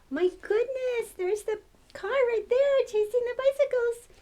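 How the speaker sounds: thrilled. Real, not acted. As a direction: ride the thrill of the car chase. Reader thrilled by chase.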